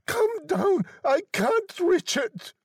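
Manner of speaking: high-pitched drawn-out calling; desperate old woman. A narrator's reading. Wife calling husband downstairs to the lock, desperate high cry. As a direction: call for help high and urgent. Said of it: "desperate and breathing heavily".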